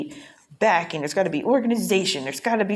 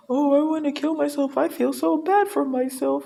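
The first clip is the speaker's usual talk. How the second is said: higher, whiny put-on voice mocking self-pity; 8 semitones higher than usual. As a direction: parody someone wallowing in pity. Mocking a self-pitying attitude.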